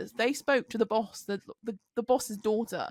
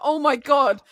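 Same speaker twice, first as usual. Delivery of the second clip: retold exasperation, loud and higher; exasperated outburst; 6 semitones higher, 10 dB louder, slower than usual. Caught between two opposite conversations, loud retold exasperation. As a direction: burst out loudly, fed up.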